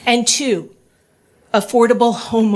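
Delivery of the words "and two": usual speaking voice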